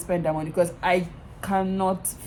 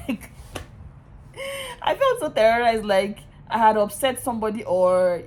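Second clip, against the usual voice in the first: ordinary delivery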